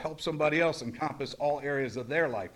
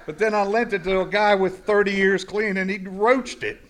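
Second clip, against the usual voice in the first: louder, higher, laughing through it; laughing disbelief; 4 semitones higher, 7 dB louder than usual. Real, not acted. Telling listeners a veteran wrecked his car, laughing. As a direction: laugh at the irony as you tell it.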